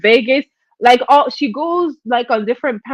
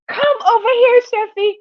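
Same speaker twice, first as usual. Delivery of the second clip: Jay Wilson beckoning; 9 semitones higher than usual. Retold or imitated. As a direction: lure her in with syrupy calls. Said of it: "Jay Wilson says".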